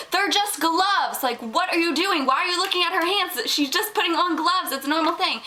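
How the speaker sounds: high-pitched indignant telling-off; retold exasperated scolding. Acting out scolding the staring boy.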